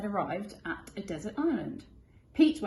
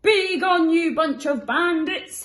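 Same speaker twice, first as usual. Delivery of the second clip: loud, higher, slow command; bellowing Pete; 10 semitones higher, 11 dB louder, slower than usual. Acted. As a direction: chase the bandits off with a bellow. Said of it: "he bellowed".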